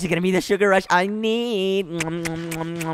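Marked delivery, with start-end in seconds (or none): 1.9-3.0 soft smacking kisses; kissing sounds. Acted. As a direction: kiss the cookies lovingly. Kissing the cookies.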